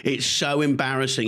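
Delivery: ordinary delivery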